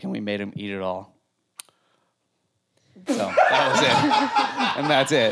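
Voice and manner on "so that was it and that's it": high laughing, drawn out. Real, not acted. Telling the cake story, bursts out laughing. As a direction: laugh through the ending.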